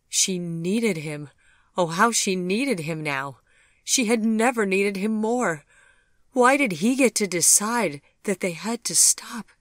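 building anguish from pleading to crying out; anguished longing. A narrator's reading. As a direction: let the yearning swell into despair. Vivian's anguished inner plea.

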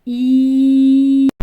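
long «І» sung out loud. Shown as a demonstration. Teacher showing the vowel to children, loud. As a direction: stretch «І» loud and clear. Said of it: "Голосний звук".

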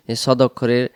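ordinary delivery

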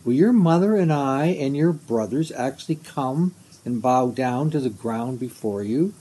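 usual speaking voice

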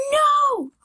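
high squealing gasp into a loud "No!"; dying pig squeal. Real, not acted. Falling off the map again, loud squealing no. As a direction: squeal the no out in real panic. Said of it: "Why do I sound like a dying pig?".

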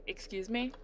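soft, incredulous rising question; retold disbelief. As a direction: quietly question an absurd order. Retold disbelief at sergeant's order.

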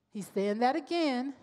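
ordinary delivery

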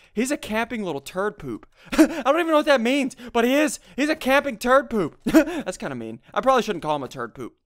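ordinary delivery

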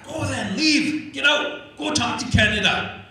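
high, loud imitated yell at him to leave; furious outburst. CEO losing it at the activist, imitated angry yell. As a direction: throw him out in a rage. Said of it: "Then he lost it".

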